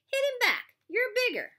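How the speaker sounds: loud, high-pitched goading; egging on. A narrator's reading. Counselor eggs boy on to fight.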